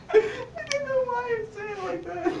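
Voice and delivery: high-pitched giggly protest; laughing. Real, not acted. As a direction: squeal it through helpless laughter. Cracking up over own accent.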